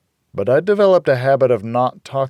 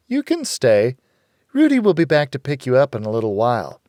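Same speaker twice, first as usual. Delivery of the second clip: higher, brisk woman; a hint of Rita, higher; Rita's voice; 4 semitones higher, 4 dB louder than usual. A narrator's reading. Rita telling the girl plans changed, higher.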